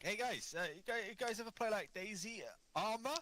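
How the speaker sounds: high, eager question to new guys; lightly mocked eager gamer. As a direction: pitch it up like a keen recruiter. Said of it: "Like that".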